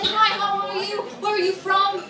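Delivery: high, polite waitress voice; imitating a chirpy waitress; friendly waitress. Retells a friendly waitress chatting.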